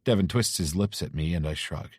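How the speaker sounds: ordinary delivery, nothing marked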